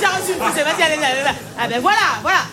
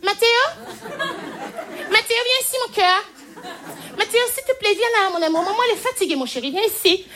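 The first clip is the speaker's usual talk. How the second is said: higher, tired honeyed coaxing; sweetly pleading mum; 5 semitones higher than usual. Retold or imitated. A mum on the metro platform calling her running child, sweet. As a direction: coax the runaway child gently. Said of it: "Sa mère".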